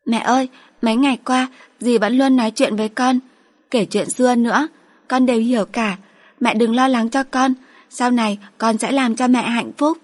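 put-on a small girl making a solemn promise; young daughter.

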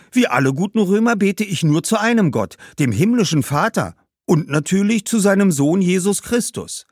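put-on high, earnest boy's voice; devout young boy. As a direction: state it innocently, pitched well up. Boy professes his faith. (frommer Junge, hohe Stimme)

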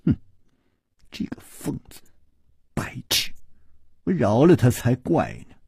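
hissed spite, mostly breathy and soft; spiteful. Acted. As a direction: spit the insults under the breath. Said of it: "恨恨地说".

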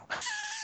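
short unvoiced chuckle; a little breath of a laugh; quick breathy laugh. Real, not acted. GM laughing at the party's plight, quick breathy chuckle. GM laughing at his own story.

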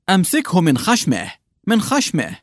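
high, insistent hunchback calling orders; urging peasant. A narrator's reading. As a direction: push him to grab it by the snout. Lubim urges in dialogue. (فلاح يلحّ بصوت عالٍ)

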